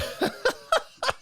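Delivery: unvoiced squeak of a laugh; breathy high laugh. Real, not acted. Laughing at his joke.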